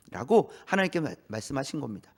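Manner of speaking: normal talking voice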